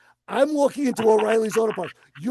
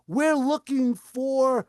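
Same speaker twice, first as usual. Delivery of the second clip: drawn-out request; 3 semitones higher, much slower than usual. Retold or imitated. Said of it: "And they say".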